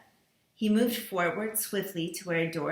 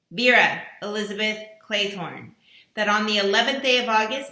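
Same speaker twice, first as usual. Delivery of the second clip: accusing recorded voice; 2 semitones higher, 5 dB louder than usual. Acted. Mysterious voice accusing each dinner guest, stern and solemn. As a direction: pronounce the charges like a judge's sentence.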